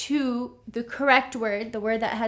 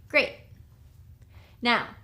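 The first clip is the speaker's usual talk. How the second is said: ordinary talk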